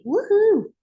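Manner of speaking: high, loud celebratory woohoo; excited whoop. Real, not acted. Host cheering on her listeners, whooping loud. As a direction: cheer like you just won. Cheering after I'm ready.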